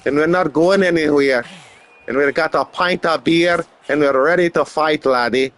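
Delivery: proud mock-Scottish tribesman; hammed-up Scottish brogue, ready to brawl; Scottish accent. Plays a proud Celtic tribesman.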